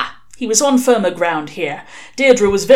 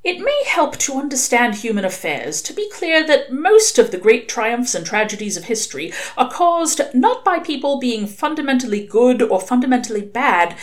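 ordinary delivery

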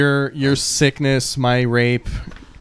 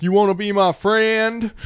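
higher-pitched Cajun swamp-dweller voice; Cajun accent; 9 semitones higher than usual. Acted. Cajun stranger on a shack porch calls to the player, high Cajun accent. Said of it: "That's a great Cajun accent".

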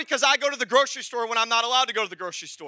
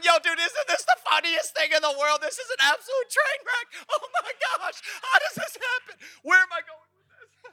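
much higher, laughing through it; laughing retelling; 8 semitones higher than usual. Retold or imitated. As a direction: cackle at the train wreck. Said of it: "And I'm saying".

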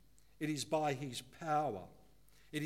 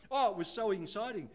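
excited churchgoer; 6 semitones higher, 7 dB louder than usual. Retold or imitated. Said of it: "you go".

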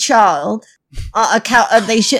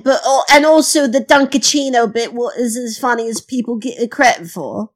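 hammed-up fake British accent; put-on British accent. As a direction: welcome listeners in a badly faked British accent. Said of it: "adopting a foreign accent".

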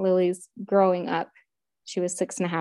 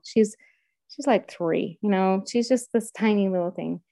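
ordinary talk, nothing marked

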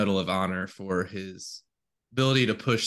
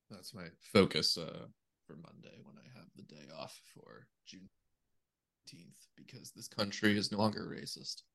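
far from mic; 2 semitones lower, 11 dB quieter than usual. Real, not acted. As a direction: voice dropping away off mic. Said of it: "stay closer to your mic".

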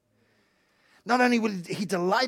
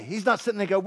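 ordinary talk, nothing marked

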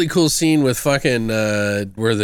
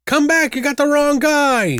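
much higher, louder hammed-up plea; high mock yell; 3 dB louder than usual. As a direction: call after someone, hammed-up and high. Quoting movie line.

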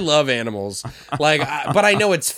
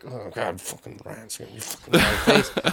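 put-on dying old man mutter; 5 semitones lower, 4 dB quieter than usual. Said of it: "And he was like".

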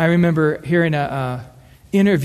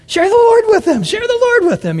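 much higher and louder, an urgent heartfelt plea; pleading inner cry; 6 dB louder than usual. Retold or imitated. Preacher voicing his heart's cry at a movie, high urgent plea. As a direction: cry it out high, begging the hero. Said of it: "I'm always watching the movie going".

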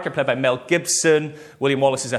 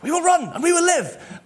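frightened soldier. Retold or imitated. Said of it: "one of them shouts out".

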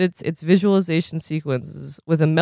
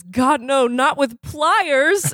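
put-on dreaming boy; 8 semitones higher, much slower than usual. A narrator's reading. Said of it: "Beak sits up and says".

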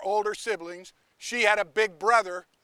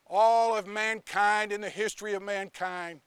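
ordinary talk, nothing marked

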